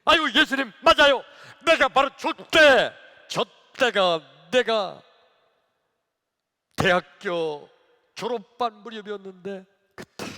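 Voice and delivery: high, loud acted outcry; worked-up lament. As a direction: cry out to Jesus in remembered hardship. Acted deacon reliving hardship to Jesus. (크고 높게 하소연하듯)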